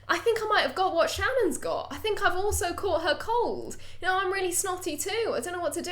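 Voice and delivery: high put-on voice of a snotty friend; Boone's voice. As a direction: whine for help, pitched high. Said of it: "And he goes".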